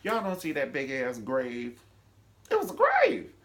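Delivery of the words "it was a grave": much higher, louder exclamation; incredulous shriek. Real, not acted. Vlogger ranting at the show, incredulous shriek. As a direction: blurt it out in disbelief.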